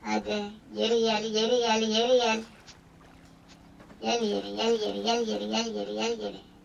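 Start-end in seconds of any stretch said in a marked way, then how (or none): none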